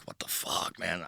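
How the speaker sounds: low breathy whisper of annoyance; whispered disbelief. Retold or imitated. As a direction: hiss it under your breath, stunned. Muttering disbelief to himself.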